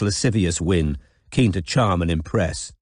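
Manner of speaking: normal talking voice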